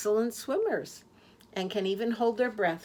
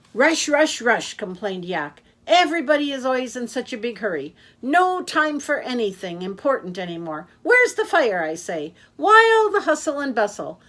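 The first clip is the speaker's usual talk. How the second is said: high, loud, fast-talking taxi driver; a cheerful blabbermouth who never stops; loud chatty Yak; 3 semitones higher, 8 dB louder than usual. Acted. Yak the taxi driver chattering to his passenger, loud and high. Yak ranting about hurry.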